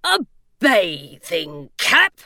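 slow, lightly gravel-free stone gargoyle asking; a grumpy gargoyle, slow and puzzled. A narrator's reading. Gargoyle voice in story.

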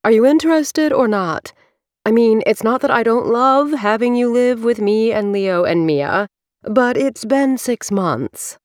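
bright, high salesy pitch, slowing at the end; realtor voice. Acted. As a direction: sell it like a realtor closing a deal. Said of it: "putting on her best realtor voice".

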